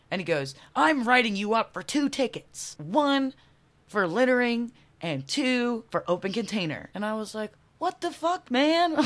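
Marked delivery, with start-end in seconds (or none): 0.7-6.9 loud, raised mock pronouncement; imitated officious cop. Cop writes her two tickets, mock officious announcement. 7.8-8.9 high-pitched incredulous protest; hammed-up outburst breaking into a laugh; mock outraged disbelief. She protests two tickets to cop, mock outrage. Acting her reaction to tickets.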